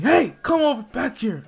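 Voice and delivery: high, loud call back; acted hero hollering; calling after someone. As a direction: shout after someone walking off. Pro hero calls after departing boy.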